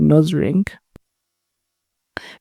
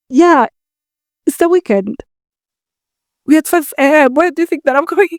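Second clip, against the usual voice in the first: exasperated reply; 9 semitones higher than usual. Retold or imitated. Retelling her groggy reply to farmer.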